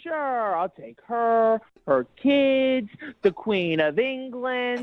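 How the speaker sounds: slow, drawn-out sarcastic agreement; mock-willing, dragging it out. Real, not acted. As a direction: agree with heavy sarcasm, stretching each item on the list. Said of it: "I'm being sarcastic".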